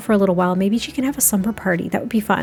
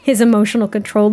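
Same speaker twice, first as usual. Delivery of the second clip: ordinary talk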